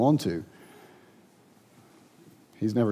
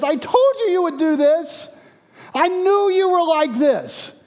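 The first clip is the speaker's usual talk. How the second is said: angry; 4 dB louder than usual. Acted. Jonah accuses God of what he foresaw, high angry wail. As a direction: hurl it at God as Jonah's bitter complaint. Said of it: "angry at God, and says".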